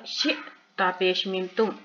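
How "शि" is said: शि stopped abruptly in the throat; hamza jerk. Shown as a demonstration. Teacher repeating for learners, शि short with a jerk. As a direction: jerk शि shut on a glottal catch. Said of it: "यहाँ फिर झटका आएगा".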